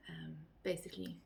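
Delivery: breathy whispered aside. Real, not acted. Whispering an aside to viewers. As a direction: drop to a whisper mid-thought. Unvoiced aside while explaining.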